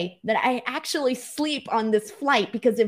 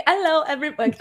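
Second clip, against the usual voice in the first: a weak attempt at an English accent; 6 semitones higher, faster than usual. Host greeting viewers from London, weak English accent. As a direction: greet everyone in a half-hearted English accent. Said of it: "That's not an English accent".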